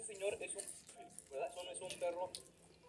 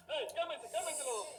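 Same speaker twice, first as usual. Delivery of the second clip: calming shout; 6 semitones higher than usual. Real, not acted. Breaking up dog fight.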